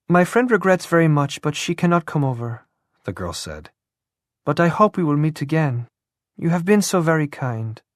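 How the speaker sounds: put-on eager, apologetic young woman pleading sweetly. A narrator's reading.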